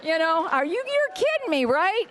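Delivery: very high, squeaky disbelief; wife's hammed-up you-can't-be-serious; incredulous disbelief. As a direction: shoot up high in mock astonishment. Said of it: "and it's like".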